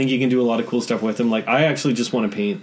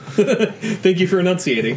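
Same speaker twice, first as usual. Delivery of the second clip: laughing; 6 semitones higher, slower than usual. Real, not acted. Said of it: "laughs while speaking".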